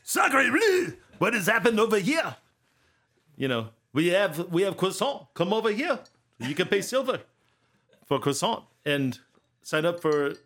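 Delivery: high, loud hammed-up French accent; a cartoon Frenchman selling croissant. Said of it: "they're French".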